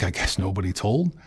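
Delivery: normal talking voice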